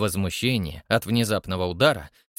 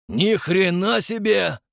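higher, slow amazed cry; astonished yell; 7 semitones higher, 4 dB louder, slower than usual. A narrator's reading. Man in the front row, astonished yell. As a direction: blurt out in amazement. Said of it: "вырвался вопль с первого ряда".

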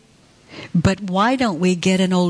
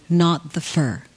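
ordinary talk, nothing marked

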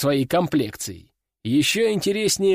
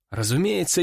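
plain reading voice

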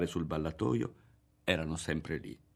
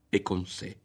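usual speaking voice

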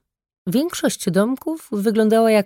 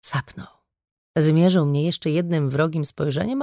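ordinary delivery, nothing marked

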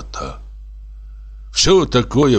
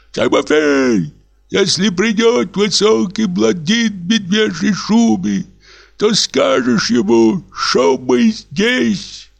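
rowdy call; 12 semitones higher, slower than usual. Acted. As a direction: shout orders like a merry drunk host. Reveller calls the waiter again.

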